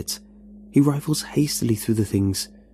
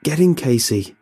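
loud, urgent bellow; 5 semitones higher, 5 dB louder than usual. A narrator's reading. Robbie bellows at Casey to get in: loud bellow. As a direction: roar the order to get in. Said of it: "Robbie bellows".

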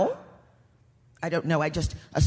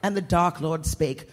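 usual speaking voice

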